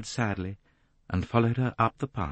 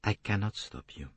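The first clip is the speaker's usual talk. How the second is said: soft, resigned admission; sad; 7 dB quieter than usual. A narrator's reading. Poirot admitting he cannot stop Jacqueline, sad. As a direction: concede it quietly, with regret. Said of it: "His voice was sad".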